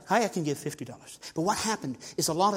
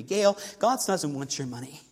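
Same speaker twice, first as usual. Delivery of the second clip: ordinary talk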